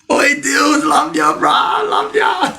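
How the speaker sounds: high, squealed shout of love; excited shout-out. Real, not acted. Streamer gushing to a viewer, excited high shout-out.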